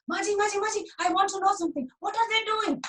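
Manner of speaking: put-on high, pleading child's voice; eager little boy. Dhani pestering his busy mother about the meeting. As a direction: tug at mother's sleeve with your voice.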